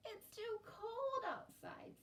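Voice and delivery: put-on high, slow whining child voice; whiny little boy. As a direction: complain like a small brother. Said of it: "said Herschel".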